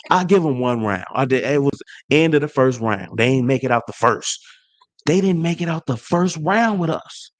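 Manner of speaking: ordinary talk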